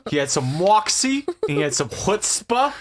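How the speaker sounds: mock New York accent. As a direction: lay on the Brooklyn accent thick. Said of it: "my New York accent".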